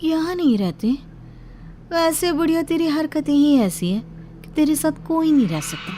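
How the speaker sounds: high-pitched, disdainful jibe; insolent snake-bride. Acted. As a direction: sneer at the old woman in a high voice. Voices the rude snake bride.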